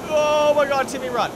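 yelling in alarm; panicked shout. Real, not acted. Panicked shout at game character.

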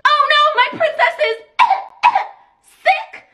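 high loud mock worry; doting mom. Mom frets over her sick princess, high mock worry.

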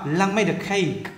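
slow model reading, "made a" run together; linked sounds. Shown as a demonstration. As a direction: glide made onto a as one word. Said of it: "đọc nối âm ờ này luôn". (Lan made a cake, nối "made a" thật chậm)

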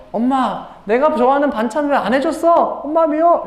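put-on high-pitched, cross child's complaint; mock a sulky kid having a go at mom; angry child. Angry child complaining to mom about side dishes, high and cross. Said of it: "화나는 것 같아요".

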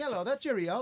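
high-pitched mock British accent; hammed-up cartoon British accent; bad British accent. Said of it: "when you guys do British accents".

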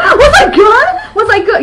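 high, slow squealing laugh of a question; giddy excitement. Retold or imitated. Dancer eagerly asking her friend about the dream, giddy. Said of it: "All excited".

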